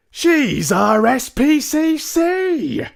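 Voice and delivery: very high, very loud and slow, drawn out in disbelief; outraged cry. Acted. Mister Jenkins protesting about the chairwoman, shrill slow cry. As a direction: shriek in horrified protest. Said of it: "cried Mister Jenkins".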